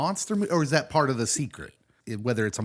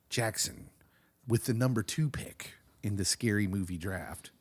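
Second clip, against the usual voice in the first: whisper; 4 dB quieter than usual. Acted. Announcing the pick.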